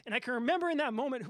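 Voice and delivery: ordinary talk, nothing marked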